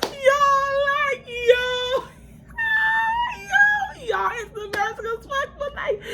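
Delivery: high-pitched shrieking yo; squealing disbelief. Real, not acted. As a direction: scream it out in embarrassed disbelief. Screaming at the embarrassing memory.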